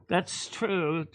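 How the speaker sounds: usual speaking voice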